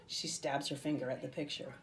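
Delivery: plain reading voice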